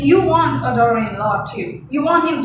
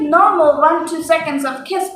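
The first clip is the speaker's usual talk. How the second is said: ordinary talk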